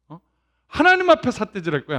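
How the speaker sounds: normal talking voice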